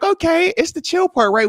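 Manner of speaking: high, breezy confident tone; light high assurance; relaxed confidence. Retold or imitated. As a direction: sure the win is safe. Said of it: "I'm like".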